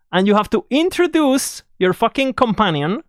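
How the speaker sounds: ordinary talk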